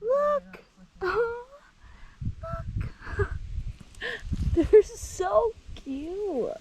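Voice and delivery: high, slow cooing over cute animals; excited cooing. Real, not acted. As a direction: squeal with delight at something tiny.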